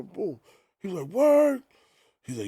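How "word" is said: high, loud surprised "Word?". Retold or imitated. Big amazed the DJ lives in Brooklyn, surprised. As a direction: sound amazed at the news.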